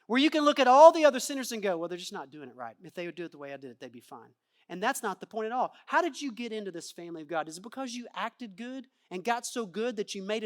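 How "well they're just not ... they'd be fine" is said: smug judgment. Acted. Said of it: "a self-righteous position".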